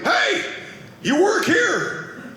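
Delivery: high-pitched mock call, words stretched; imitating hailing customer. Retold customer runs up calling out.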